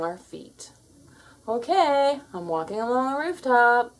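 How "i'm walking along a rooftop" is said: usual speaking voice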